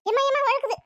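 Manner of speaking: loud, high cry for help; alarmed call. Acted. Woman washing dishes calls out as they slip, alarmed.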